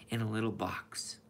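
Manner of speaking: hushed whisper, barely voiced. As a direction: breathe it as a secret. Whispered movie quote.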